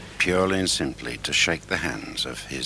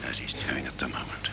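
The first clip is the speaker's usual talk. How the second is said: snooker commentary whisper; 3 semitones lower, 7 dB quieter, faster than usual. Real, not acted.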